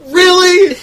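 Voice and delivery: loud, high incredulous "really?"; hammed-up disbelief; mock exasperation. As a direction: call it out like a fed-up moviegoer. Imagined exasperated audience member.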